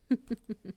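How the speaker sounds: high, breathy rippling giggle; flirty giggle. Acted. Spider-woman flirting back at her patient, coy giggle. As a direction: giggle coyly through the breath.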